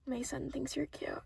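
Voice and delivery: hushed whisper. Retold or imitated. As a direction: whisper it like a secret. Whispered gossip at counter.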